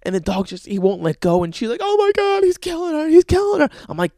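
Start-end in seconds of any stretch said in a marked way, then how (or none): 1.8-3.7 very high, loud panicked wail; mock hysterical scream. Girlfriend sees dog killing her bird, mock hysterical screaming.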